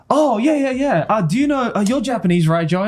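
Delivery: eager voice, lifted high; imitating Mario. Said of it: "he was like".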